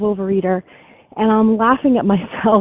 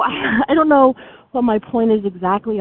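ordinary talk, nothing marked

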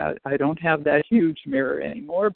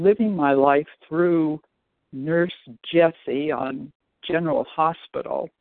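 ordinary delivery

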